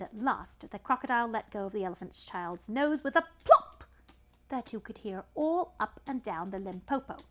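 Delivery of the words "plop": a sudden loud popping plop. Acted. Narrator making the loud plop of the nose. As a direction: pop it out like a cork. Sound effect word.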